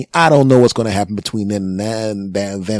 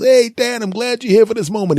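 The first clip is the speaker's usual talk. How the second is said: excited son; 13 semitones higher than usual. Acted. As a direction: call out like a thrilled son. Son voiced at basketball game.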